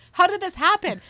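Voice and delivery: high, loud stunned cry; imitating her own amazed outburst; retold disbelief. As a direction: act out the shock of finding the ring. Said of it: "I was like".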